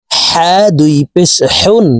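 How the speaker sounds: হা and হেউন with a tight h from mid-throat. Shown as a demonstration. Teacher contrasting two ha letters, mid-throat হেউন. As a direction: squeeze the h of হেউন from the middle of the throat. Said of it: "হা হরফের উচ্চারণ হলকের মধ্যখান থেকে".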